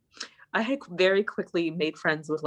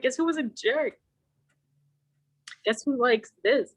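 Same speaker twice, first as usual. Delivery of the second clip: mock complaint to Mom; 8 semitones higher than usual. Acted child complaining to Mom.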